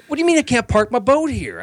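high, indignant whiny voice; squeaky put-on outrage; mock entitled celebrity. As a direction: whine like a spoiled star who is refused. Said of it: "He's like".

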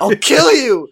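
high, loud hammed-up scream; screamed threat. A narrator's reading. Narrator threatening the man who attacked her family, high hammed-up scream. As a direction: scream it out in mock rage. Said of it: "I screamed".

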